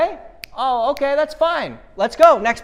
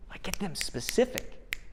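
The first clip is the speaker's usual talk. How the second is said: whisper; 9 dB quieter, slower than usual. Real, not acted. Aside while coaching managers.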